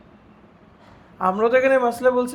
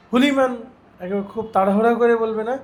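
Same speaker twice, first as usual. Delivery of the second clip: normal talking voice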